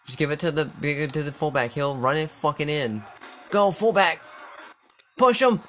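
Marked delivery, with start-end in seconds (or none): 3.5-5.6 loud cheering. Real, not acted. Gamer urging fullback on.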